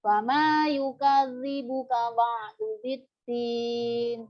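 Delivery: ordinary talk, nothing marked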